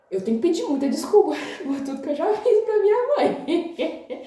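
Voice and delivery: half laughing, half pleading. Retold or imitated. Thinking about her mother during labour, laughing.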